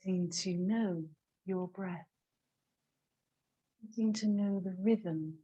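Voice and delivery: soft, hushed meditation voice with long pauses; guided meditation. Real, not acted. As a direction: guide them quietly into watching the breath. Leads a breathing meditation.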